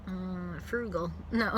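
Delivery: normal talking voice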